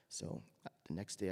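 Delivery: normal talking voice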